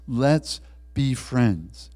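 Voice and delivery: very slow, weighted words; earnest invitation. Acted. Christ speaks to the congregation, slow and earnest. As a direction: stretch each word out as an offer.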